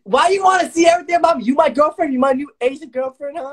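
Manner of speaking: fast, loud and high mocking yell; fast high taunting shout; heated yelling. Real, not acted. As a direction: rattle it off loud to shut him down. Heated insult argument.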